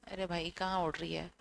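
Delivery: ordinary talk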